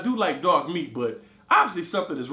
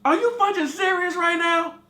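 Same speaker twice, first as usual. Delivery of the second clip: exasperated outrage; 12 semitones higher, 5 dB louder than usual. Retold or imitated.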